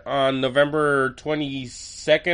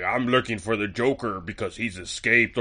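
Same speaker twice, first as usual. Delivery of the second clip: Batman voice; 6 semitones lower, 6 dB quieter than usual. Retold or imitated. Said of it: "Batman to appear and be like".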